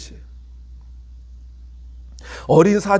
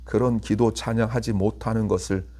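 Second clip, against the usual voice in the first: prayer; 6 semitones lower, 4 dB quieter than usual. Acted. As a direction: pray humbly and quietly. Closing prayer.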